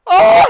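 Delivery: loud high cry of burning; pained cry. Real, not acted. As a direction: wail at the heat. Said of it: "Now I'm sweating bullets".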